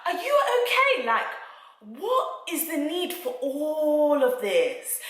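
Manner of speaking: high, disbelieving, slowed at the end; stunned disbelief at her outburst; imitating her own baffled reaction; incredulous. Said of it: "I was looking at this woman like".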